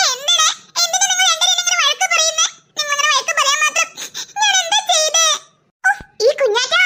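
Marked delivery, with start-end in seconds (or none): 0.1-5.3 hammed-up tearful protest, pitched high; fake crying. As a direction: pretend to cry at being scolded. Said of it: "നീ ഇപ്പൊ എന്തിനാ കരയുന്നേ". (കരച്ചിൽ നടിച്ച്)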